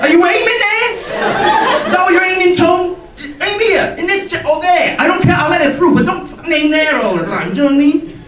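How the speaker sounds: high, squeaky, aggrieved plea; mock outraged goalie. Goalie telling the striker off, squeaky and aggrieved. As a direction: complain like a hurt goalkeeper. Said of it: "So I'd be like".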